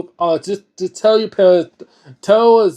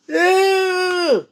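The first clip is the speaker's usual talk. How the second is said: high, squealed ew of disgust; 12 semitones higher, 3 dB quieter than usual. Real, not acted. Reactor disgusted at story.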